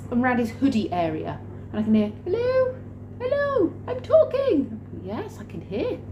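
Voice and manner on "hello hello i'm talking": very high, squeaky mimicry; pitched right up, calling hello; little voice. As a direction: imitate a tiny voice coming from an earpiece. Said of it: "I can hear this voice going".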